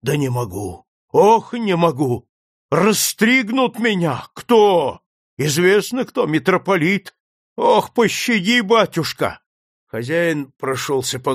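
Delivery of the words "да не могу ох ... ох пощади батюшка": frantic pleading. Acted. Frightened priest begs.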